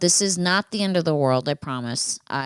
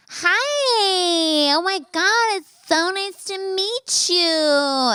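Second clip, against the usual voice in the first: very high, drawn-out effusive greeting; much slower than usual. Retold or imitated. Date greeting her at the door, mocked as high and effeminate. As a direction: gush like a campy date, way up high. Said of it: "more feminine than I did".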